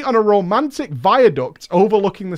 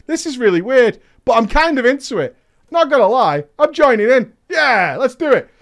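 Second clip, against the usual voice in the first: a hint of an eager dad, higher and louder; imagined dad joining in; 6 semitones higher, 5 dB louder than usual. Reader imagining dad joining the accent game, playful eagerness. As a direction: lightly voice a dad getting into it. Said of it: "Your dad was like".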